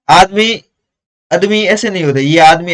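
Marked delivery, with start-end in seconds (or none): none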